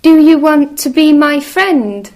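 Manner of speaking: high, loud eager little mouse. Acted. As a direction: call out brightly like a tiny mouse. Mouse asks each animal.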